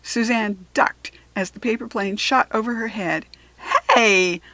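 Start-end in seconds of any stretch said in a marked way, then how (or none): 3.9-4.4 loud, slow annoyed shout. A narrator's reading. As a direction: snap at him to watch out. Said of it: "she shouted at Kevin".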